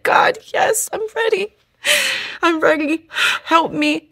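high, broken, tearful whisper; crying out barely above a breath; sobbing surrender. Real, not acted. Woman answering God in her cell, sobbing.

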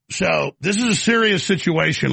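ordinary talk

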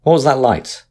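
high, loud urgent call. A narrator's reading. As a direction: shout the question across deck. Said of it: "sang out the captain".

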